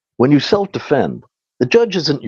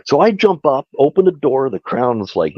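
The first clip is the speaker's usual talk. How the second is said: usual speaking voice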